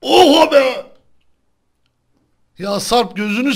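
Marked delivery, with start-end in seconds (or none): 0.0-0.9 startled exclamation. Real, not acted. Reacting to teammate falling.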